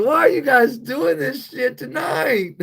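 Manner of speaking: high-pitched incredulous complaint; mock exasperation. Host retelling his reaction to viewers, mock exasperation.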